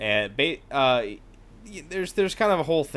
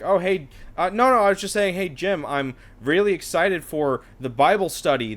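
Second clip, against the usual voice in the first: higher, louder, hurried backpedal into careful emphasis; mock innocent cover-up; 7 semitones higher, 6 dB louder than usual. Coworker covers up the party before Jorge, mock innocent backpedal. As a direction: play caught out and acting oh-so-innocent. Acted hasty cover-up to the grouch.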